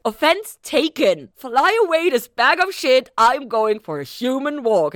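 a hammed-up furious Linda; sharp comeback. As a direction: snap back at him in fun. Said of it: "And she's like".

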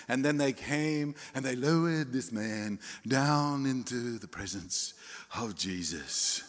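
mock-pious storybook delivery in a refined English accent; sanitized Bible reading. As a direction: make the story sound neat and holy. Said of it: "we read the story and we sanitize it".

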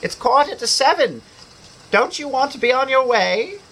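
a mother calling her son; mother's voice. A narrator's reading. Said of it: "It was his mother".

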